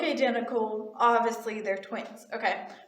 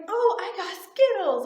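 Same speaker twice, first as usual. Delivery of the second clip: put-on high, louder kid voice; pitched-up childish excitement; excited little girl; 8 semitones higher, 5 dB louder than usual. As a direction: imitate a child showing off a treat. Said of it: "she was so excited".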